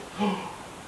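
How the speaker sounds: sharp unvoiced intake of breath; voiceless gasp. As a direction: gasp in shock. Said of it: "I'm like".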